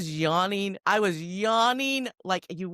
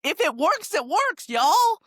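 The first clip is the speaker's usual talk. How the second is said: high and loud, delighted with her point; gleeful high exclaim; 13 semitones higher, 7 dB louder, slower than usual. Real, not acted. Telling her co-host, a high delighted exclaim. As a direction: crow it out, pitch way up.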